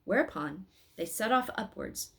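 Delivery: ordinary delivery, nothing marked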